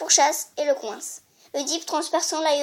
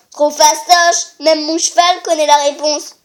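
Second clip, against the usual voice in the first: mock laughing; 8 dB louder than usual. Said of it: "Oedipe rigolant".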